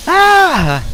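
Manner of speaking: sudden high shriek of fright; startled scream. Acted. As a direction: shriek as if something jumped out. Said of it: "I'm not doing a girly scream".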